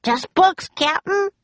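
very high henchman's shout, rising ends. A narrator's reading. Stinky reports to Captain Bones, shouting high. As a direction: call it out high to your captain. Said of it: "shouted Stinky".

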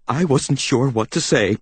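plain reading voice

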